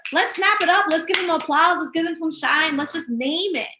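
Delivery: rally the staff with a lifted voice; upbeat cheerleading. Acted. Voicing a simple team celebration.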